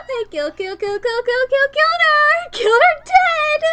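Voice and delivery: high, sobbing, shrieking laughter through words; hysterical laughing. Acted. Crazed girl exults over her rival's death, hysterical laughing shriek. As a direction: lose your mind with glee.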